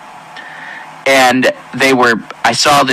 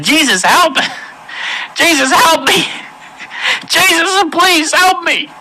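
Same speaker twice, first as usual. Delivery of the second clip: panicked, much higher pleading cry; desperate cry for help. Retold or imitated. Retelling his own terrified plea in hell, high desperate cry. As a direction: scream for Jesus to save you, voice cracking high. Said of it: "I started to cry out".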